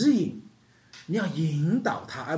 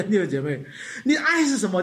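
usual speaking voice

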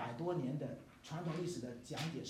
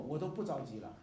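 normal talking voice

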